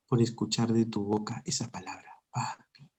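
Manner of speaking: soft unvoiced whisper. Real, not acted. Preacher whispering to the congregation how the Father longs to hear papi. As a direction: breathe it out as a tender secret. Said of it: "whispered tender climax".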